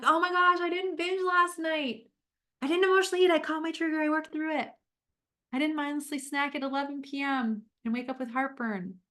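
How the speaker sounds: high, loud, elated self-talk; imagined self cheering after a good night; thrilled inner voice. Pretend. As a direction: gush like you can't believe it worked.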